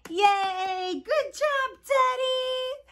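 high, bright-eyed praise for the teddy; excited cheer. Acted. Host cheering Teddy the bear for playing, excited and high.

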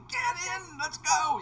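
high urgent call; husband hurrying wife out to the car, high. Retold or imitated. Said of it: "She comes out".

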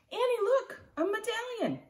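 put-on boy calling out about his find. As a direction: wave her over with your voice. Jack calls to distracted sister.

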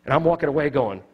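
ordinary delivery

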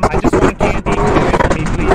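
loud pleading whine; begging loudly for candy; loud whining. Real, not acted.

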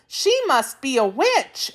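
put-on high girlish exclamation; exclaiming. A narrator's reading. Dorothy guessing about the princess, high exclamation. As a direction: blurt it in a high young voice. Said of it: "exclaimed the girl".

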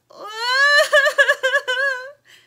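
high, loud bawling wail. A narrator's reading. Anna bursting into tears before the chickens, loud wail. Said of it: "Anna burst into tears".

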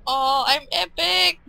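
slow, loud, then a high shout; gloating cheer. Real, not acted. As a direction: drag out the boast, then yell it. Gloating after the fight.

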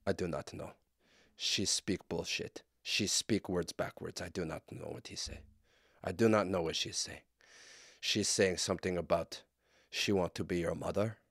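put-on plodding confused translator, Russian accent; mock Russian translator. Translator explaining to Putin, Russian accent. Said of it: "And he'd be like".

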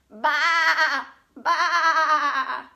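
loud bleating baa, laughing; sheep baa. Pretend. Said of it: "What does a sheep say".

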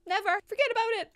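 rising to a high, loud no way; emphatic shout. Real, not acted. Refusing ever to play again.

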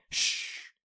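drawn-out hushing hiss; voiceless shh; long shush. A narrator's reading. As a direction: shush a whole gym. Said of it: "but then everyone said".

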